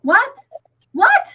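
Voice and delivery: high, loud yelped questions; high-pitched disbelief, voice jumping up; stunned delight. Real, not acted. Livestream host reacting to a viewer's news, stunned.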